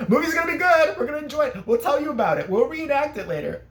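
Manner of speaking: high, gleeful taunt; mock gloating. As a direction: call out tauntingly to those shut out. Taunting those left outside the theater.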